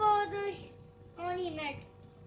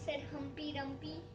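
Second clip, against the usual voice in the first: ordinary delivery, nothing marked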